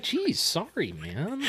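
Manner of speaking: soft, retold huffy apology; offended. Apologising to pissed friend over popcorn, offended. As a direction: back off quietly, stung. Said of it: "I got offended".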